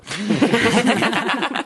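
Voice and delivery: a short, high mocking laugh. Real, not acted. Reviewer mocking the perfume ad with a high laugh. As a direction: scoff at the perfume slogan.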